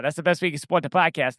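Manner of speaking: usual speaking voice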